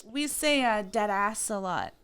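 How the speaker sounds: usual speaking voice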